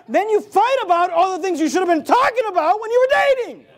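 high, emphatic preacher's rant; forceful preaching. Acted. Preacher chiding dating couples over wasted time, forceful preaching. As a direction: build to a loud, high scolding.

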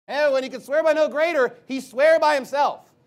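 loud, high, punched-out Bible verse; emphatic preaching. Real, not acted. Preacher repeating Hebrews to press his point, loud and emphatic. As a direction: raise the voice and hammer the verse home. Hammering home a quoted verse.

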